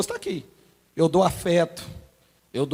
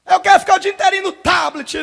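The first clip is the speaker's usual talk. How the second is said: put-on whiny child; 6 semitones higher, 4 dB louder than usual. Child demands, voiced.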